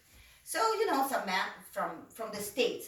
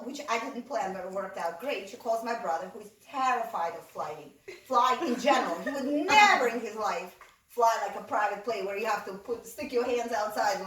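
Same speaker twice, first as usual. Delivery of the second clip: usual speaking voice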